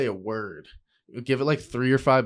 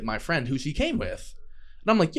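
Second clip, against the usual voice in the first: ordinary delivery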